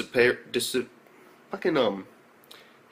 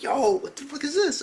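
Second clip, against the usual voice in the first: high-pitched, stunned question; acted disbelief; 13 semitones higher than usual. Reviewer reacting to Matrix-style dodging, acted disbelief. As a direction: replay your bafflement, voice way up.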